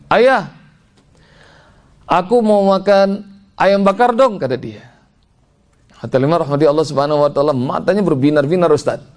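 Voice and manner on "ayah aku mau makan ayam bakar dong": put-on higher, drawn-out child's request; young daughter asking. Eight-year-old asks ayah for grilled chicken, higher child's plea. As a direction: plead like a little girl to her dad. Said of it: "anak saya perempuan ngomong gini".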